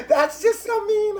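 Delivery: laughing protest. Real, not acted. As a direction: squeal it out still laughing. Laughing at own jab.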